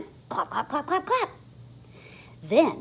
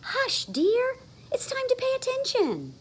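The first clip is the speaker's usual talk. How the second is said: higher, lightly voiced kindly teacher; a hint of a gentle teacher. Teacher hushing Froggy in class, lightly higher. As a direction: gently hush a child.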